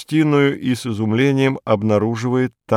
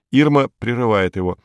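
ordinary delivery, nothing marked

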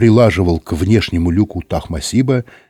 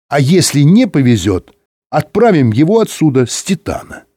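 higher-pitched, brisk official giving orders; radio voice of the director; 4 semitones higher, 4 dB louder than usual. Acted. (голос директора по рации, повыше)

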